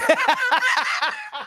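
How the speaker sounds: a high rising laugh. Real, not acted. Streamer laughing that [name] goes home with that, high laugh. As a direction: laugh up into a squeal.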